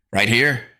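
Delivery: put-on scared boy; nervous gulp. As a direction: ask it nervously. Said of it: "he asked with a gulp".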